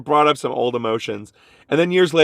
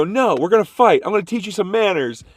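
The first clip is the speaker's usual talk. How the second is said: defiant challenge; 7 semitones higher than usual. Retold or imitated. Teen challenging coworker to fight.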